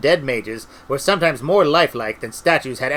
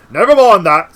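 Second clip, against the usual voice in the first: loud brush-off, moderately raised pitch; firm interruption; 4 semitones higher, 10 dB louder than usual. A narrator's reading. Cutangle cutting Treatle's objection off, loud and firm. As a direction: cut in loudly, keeping the voice less shrill. Retake as Cutangle, less high.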